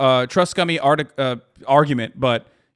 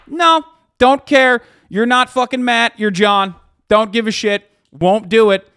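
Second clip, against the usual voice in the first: mock stubborn refusal; 5 semitones higher, 5 dB louder than usual. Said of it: "if you were just like".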